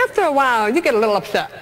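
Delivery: normal talking voice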